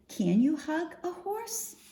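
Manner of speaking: usual speaking voice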